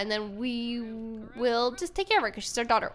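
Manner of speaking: normal talking voice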